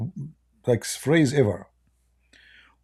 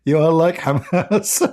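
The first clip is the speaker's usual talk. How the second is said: laughing while talking; 6 semitones higher, 5 dB louder than usual. Real, not acted. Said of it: "laughing so hard I can't even breathe".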